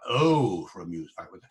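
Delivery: light Welsh lilt with a pure, loud "Oh". Shown as a demonstration. Lecturer showing the Welsh-style vowel. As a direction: give "Oh" a Welsh ring. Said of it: "that's Wales here".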